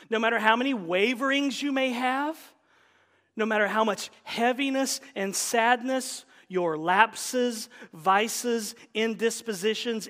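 loud, driving sermon delivery; reading a quote from the pulpit, loud and driving; full-force preaching. Acted. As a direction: preach it out to the congregation.

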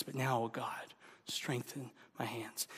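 soft, low murmured plea; hushed prayer. Acted. Nehemiah praying for strength, hushed and low. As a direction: pray it quietly to God.

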